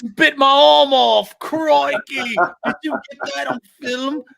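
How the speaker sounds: put-on very high, drawn-out Australian accent; over-the-top Aussie thrilled at being mauled; Steve Irwin. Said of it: "He's like".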